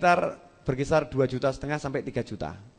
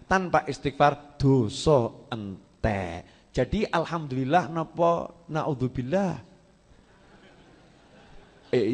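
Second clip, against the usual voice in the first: ordinary talk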